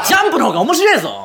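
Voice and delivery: high-pitched mock-angry insistence; comic ranting. Host telling listener off for the show, comic ranting. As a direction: push the point home, half laughing. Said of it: "皆さんの失敗を即興でのしる".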